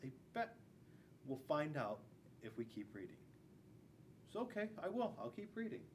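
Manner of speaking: usual speaking voice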